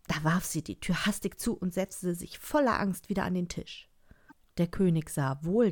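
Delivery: plain reading voice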